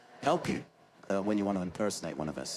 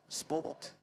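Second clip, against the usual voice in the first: "sport" ending like British "court"; British "sport", R left silent; British accent; 8 semitones higher, 6 dB quieter than usual. Shown as a demonstration. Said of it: "the ending of that word is exactly the same".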